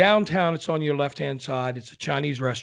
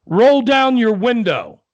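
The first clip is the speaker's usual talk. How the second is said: put-on higher, louder, slow old husband; old husband's voice; 9 semitones higher, 10 dB louder, slower than usual. Old husband to deaf wife in car, loud slow. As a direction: bark it slowly at a deaf wife. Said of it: "With accents and all".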